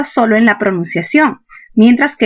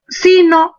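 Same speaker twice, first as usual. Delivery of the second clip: sino said as SI-no; stress on SI; 8 semitones higher than usual. Shown as a demonstration. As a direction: put the force on si in sino, not on no. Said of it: "la mayor fuerza no está en el no".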